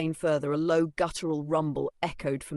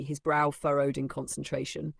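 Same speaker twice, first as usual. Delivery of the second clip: usual speaking voice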